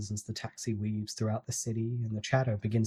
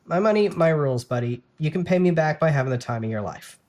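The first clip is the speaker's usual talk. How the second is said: louder, higher playful insistence; bossy teasing; 4 semitones higher, 7 dB louder than usual. A narrator's reading. Jenna teasing her brother about money, loud and bossy. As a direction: lay down the rules playfully.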